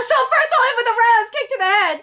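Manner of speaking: high, excited character voice; eager fighter. Acted. Planning the first kick on meeting him, high and eager. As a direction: announce the attack high and keen. Same line redone in character.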